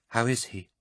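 high, soft, worried ask; anxious question. A narrator's reading. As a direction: fear in her voice. Anxious woman asks.

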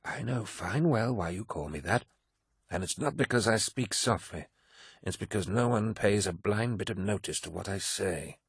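put-on old warrior murmuring a confidence; quiet confiding. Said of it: "quietly so that none of the deck crew could hear".

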